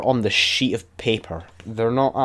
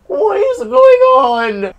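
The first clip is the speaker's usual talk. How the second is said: stretched-out squeal much higher and louder; squeaky exasperated cry; 9 dB louder, much slower than usual. Real, not acted. Gamer baffled by the level, squeaking in slow disbelief. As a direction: wail it out slowly in a squeak of disbelief. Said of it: "I sound like fucking shit".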